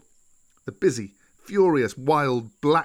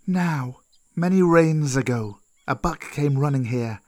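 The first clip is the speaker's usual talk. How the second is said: put-on old snake Kaa; 2 semitones higher than usual. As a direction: tell it deliberately as an old snake.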